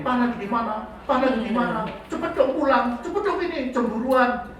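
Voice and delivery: lightly voiced wife pestering him to come home; jealous wife on the phone. Wife phoning her husband, lightly nagging and jealous.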